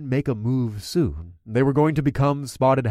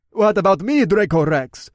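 higher, louder character voice asking; questioning Jonas; 7 semitones higher, 5 dB louder than usual. A narrator's reading. Follower asks the hero for his orders, questioning. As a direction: put the question eagerly, raised in pitch. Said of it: "Jonas questioned".

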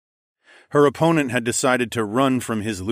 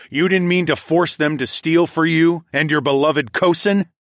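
angry interrogation; 6 semitones higher, 5 dB louder than usual. A narrator's reading. Interrogating the guard angrily.

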